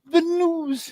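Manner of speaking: urgent wife at the door; anxious wife. Acted.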